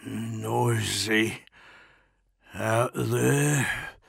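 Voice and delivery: slow, slurred mutter; muttered through blood. A narrator's reading. Tortured prisoner remarking on the battle, muttering. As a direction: mumble it through a bloodied mouth. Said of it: "muttered through the blood oozing out of his mouth".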